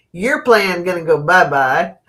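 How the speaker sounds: ordinary talk, nothing marked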